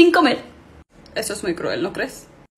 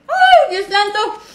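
ecstatic; 8 semitones higher, much slower than usual. Acted. Overjoyed eating.

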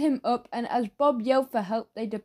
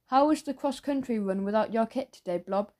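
ordinary delivery

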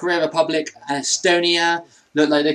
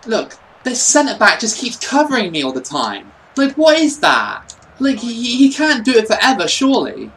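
higher, louder whinge at the game; fed-up, raised-voice protest; exasperated complaint; 7 semitones higher, 4 dB louder than usual. Real, not acted. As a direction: complain in rising frustration. Complaining about defender covering him.

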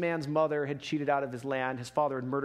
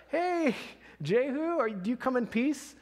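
pitched higher, calling up the road to the rider; a guard shouting out to Jehu, higher and urgent; messenger calling out; 7 semitones higher, slower than usual. Acted. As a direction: hail the approaching rider from a distance, a bit anxious.

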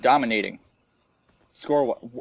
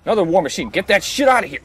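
loud, higher angry shout at the game; angry yell; 7 semitones higher, 10 dB louder than usual. Real, not acted. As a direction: yell it out in frustration. Enemy war machine appears.

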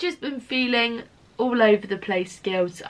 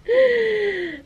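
shocked gasp; 11 semitones higher than usual. Retold or imitated. Learning favourite customer died.

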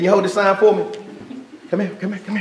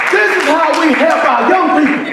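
rising shout from the pulpit; preaching at full force; 4 semitones higher than usual. Acted. Pastor preaching to the congregation, shouting.

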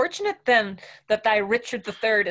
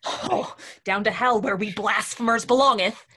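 panicked; 3 semitones higher, 5 dB louder than usual. Acted. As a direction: blurt it out in a panic. Kit panicked.

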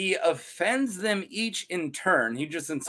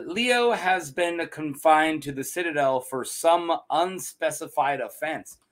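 usual speaking voice

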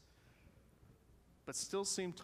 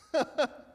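high, loud mocking laugh; chuckling laugh; 10 semitones higher, 8 dB louder than usual. A narrator's reading. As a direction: burst out in a short scornful laugh. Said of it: "and chuckled, laughed".

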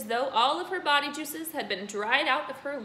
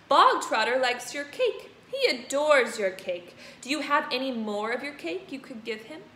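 gloating headmistress; 3 semitones higher, 6 dB louder than usual. Acted. Headmistress gloating to the cook, loud. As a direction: relish the trap.

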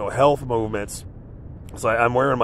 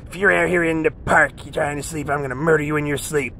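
higher, mimicking the monster's threat; menacing threat; 4 semitones higher than usual. Homeless man retelling a stranger's threat, mock menacing voice. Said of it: "it's like".

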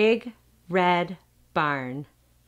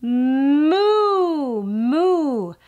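loud, high, drawn-out mooing; cow moo; 7 semitones higher, 7 dB louder than usual. Pretend. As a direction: moo big for the child like a cow. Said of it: "What does the cow say?".

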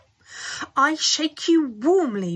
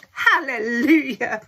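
loud, slow, drawn-out cry of joy; jubilant yell; 3 semitones higher, 7 dB louder, slower than usual. A narrator's reading. Grandpa Joe celebrating his chance to go, slow loud yell. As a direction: yell it to the rafters. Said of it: "yelled Grandpa Joe".

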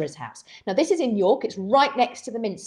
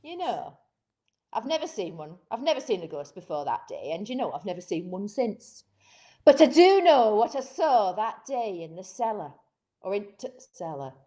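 put-on best Yorkshire accent. Host imitates Harry recalling the cellar, Yorkshire accent. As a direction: tell it as an old Yorkshire tale. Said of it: "in my best Yorkshire accent".

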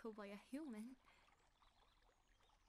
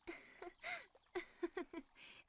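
giggle; 7 semitones higher, 4 dB louder than usual. Acted. Laughing at his reaction.

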